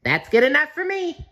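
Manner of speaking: high, bright squeaky cartoon sponge voice; cheerful SpongeBob. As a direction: chirp it like a happy SpongeBob. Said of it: "SpongeBob said".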